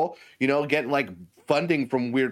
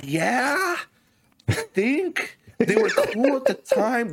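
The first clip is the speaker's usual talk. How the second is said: higher, slow sheepish answer; sheepish mock reply; 10 semitones higher, much slower than usual. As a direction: drag it out like a guilty excuse. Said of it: "It's like".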